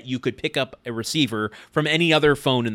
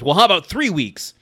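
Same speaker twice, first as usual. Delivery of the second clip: louder, higher mock-stern comeback; displeased dad; 5 semitones higher, 6 dB louder than usual. Dad who does not like the backtalk, mock-stern threat. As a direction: raise the threat with mild indignation. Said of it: "Tim does not like that and says".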